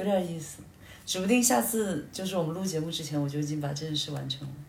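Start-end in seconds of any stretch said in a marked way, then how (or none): none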